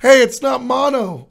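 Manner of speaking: high, bright good-news voice; chirpy upbeat announcement; cheerful doctor. Retold or imitated. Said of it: "I'm like".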